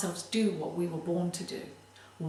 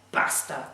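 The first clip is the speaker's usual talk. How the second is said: "Basta." said firmly and clearly, whole word punched; a clear, determined stop: "Basta."; one firm, emphatic "Basta.". Shown as a demonstration. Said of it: "in a very determined, very clear way, with lots of lovely emphasis on it".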